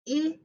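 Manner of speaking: I, the quick short i of it; short i sound. Shown as a demonstration. Teacher modelling I for the class. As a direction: say I as the short vowel in it. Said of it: "I as in it".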